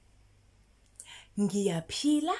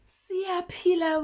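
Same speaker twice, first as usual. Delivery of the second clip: bright model; 9 semitones higher, 5 dB louder, slower than usual. Shown as a demonstration. Modelling answer.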